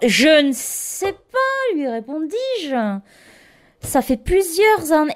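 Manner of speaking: high, slow, strained answer; losing her calm. Retold or imitated. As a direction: say it as if patience is snapping. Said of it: "j'étais plus du tout détendue".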